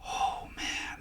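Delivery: hushed breathless oh man; whispered amazement; awed whisper. Retold or imitated. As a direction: breathe it out in awe. Awed reaction to good news.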